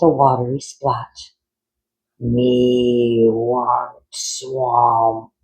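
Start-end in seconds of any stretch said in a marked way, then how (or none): 2.2-5.3 very slow, sing-song dim creature voice; monster frog. Acted. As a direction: drag it out like a simple-minded frog monster. Simple monster frog speaks.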